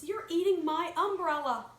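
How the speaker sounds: a tiny voice protesting; little voice. Acted. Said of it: "said a little voice".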